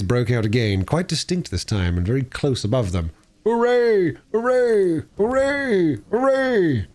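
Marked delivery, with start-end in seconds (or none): 3.4-6.9 high, far-off crowd of weasels cheering; rowdy cheering. Acted. Weasels cheering at the banquet, high and rowdy. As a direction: cheer like a drunken little crowd.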